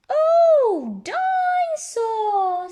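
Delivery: put-on very high, loud and slow toddler squeal; little George voice. Little brother delighted by a toy, high slow squeal. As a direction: squeal it like an excited little piglet.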